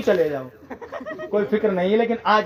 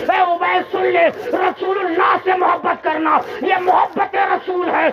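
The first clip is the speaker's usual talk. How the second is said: full-force preaching; 6 semitones higher than usual. Acted. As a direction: cry it out to the gathering. (पूरे जोश से ऊँची आवाज़ में तक़रीर)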